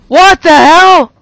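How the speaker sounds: slow loud disbelief; stunned outburst; shocked. Real, not acted. Shocked at monster.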